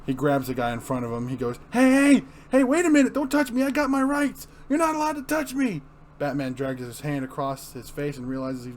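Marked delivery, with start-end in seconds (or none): 1.7-5.8 high, loud, frightened protest; panicked yelp. Acted. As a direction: squeal in panic at being grabbed. Prisoner panics, grabbed.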